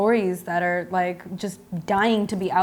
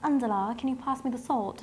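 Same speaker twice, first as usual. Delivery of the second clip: higher, imitated English accent; 5 semitones higher, 4 dB quieter than usual. Guest as London friend asking for the salt, English accent. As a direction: mimic a friend's strong English accent politely. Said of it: "She has a very strong English accent".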